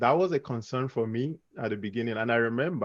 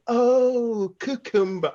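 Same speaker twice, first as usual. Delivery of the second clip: long high "oh", louder, then the word; exaggerated dawning understanding, pitched way up; mock sudden realisation; 12 semitones higher, 6 dB louder, much slower than usual. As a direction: imitate a kid finally getting it. Son finally gets it, acted.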